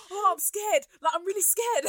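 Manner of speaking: high, loud hammed-up fright; mock fear. Imitating the boxer scared in the trial, mock fear. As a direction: imitate a scared man pleading.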